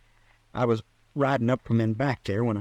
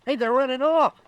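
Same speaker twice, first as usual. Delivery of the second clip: high, excited call; excited alarm; 12 semitones higher, 4 dB louder than usual. Acted. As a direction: raise the alarm as they flee. Sees the men fleeing.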